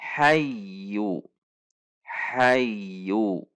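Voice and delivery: "Hayyu" gliding into a held y, without a jerk; smooth doubled y. Shown as a demonstration. Teacher models ya tasydid, glided without a jerk. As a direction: lean smoothly into the y of "hayyu", then release. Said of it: "Maka dibaca masuk dengan tidak dihentak".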